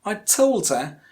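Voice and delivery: TOLD long and strong, I and her light; stress on TOLD. Shown as a demonstration. Teacher giving an example sentence, stressed on TOLD. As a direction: lean on the word told. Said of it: "the stressed word in this sentence is told".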